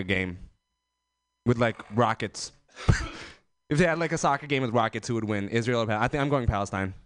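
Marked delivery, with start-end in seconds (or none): none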